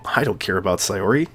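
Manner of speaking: normal talking voice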